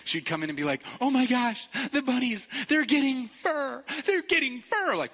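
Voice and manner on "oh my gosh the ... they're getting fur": high, loud, slowed gush of delight; ecstatic. Retold or imitated. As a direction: imitate an overjoyed student squealing. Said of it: "she was, like, ecstatic".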